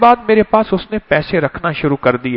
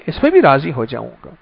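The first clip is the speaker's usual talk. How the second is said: ordinary delivery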